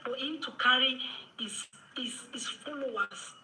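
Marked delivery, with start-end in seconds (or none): 1.4-3.4 sarcastic whisper. Real, not acted. Commentator mocking a rival's followers, whispered.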